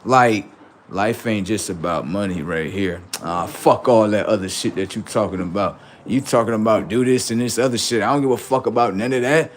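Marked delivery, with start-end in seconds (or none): none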